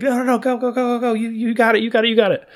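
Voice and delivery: rally a friend, go go go; cheering. Retold or imitated. Allies urging Kiryu on during the fight, high and excited.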